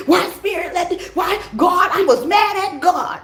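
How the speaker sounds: high, shouted whine at God; mock complaining believer. Mocking people who blame God when bad things happen, high shouted whine. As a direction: play someone wailing at God.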